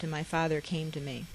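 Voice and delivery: ordinary delivery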